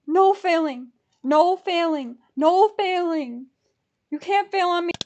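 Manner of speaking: loud, repeated chant-like warning; insistent command. Real, not acted. Ticket buyer to the payment page, insistent.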